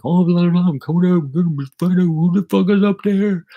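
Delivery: high, loud mock-menacing holler; angry brother. Brother hunts for intruders upstairs, mock-angry holler.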